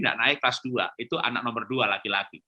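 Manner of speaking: usual speaking voice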